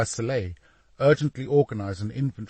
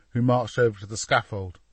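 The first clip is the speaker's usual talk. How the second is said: plain reading voice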